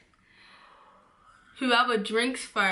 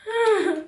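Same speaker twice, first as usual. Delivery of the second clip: short high laugh; burst of laughter; 10 semitones higher than usual. Real, not acted. Laugh after tasting.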